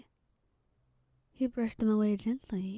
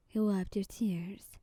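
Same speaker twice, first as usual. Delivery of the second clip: ordinary delivery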